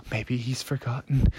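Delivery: hushed, gasping hope; pant, then very softly let it out; panicked heavy breathing. Acted. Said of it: "He started breathing really heavy".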